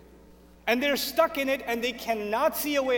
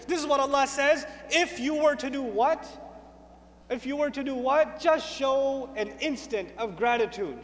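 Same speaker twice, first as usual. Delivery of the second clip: usual speaking voice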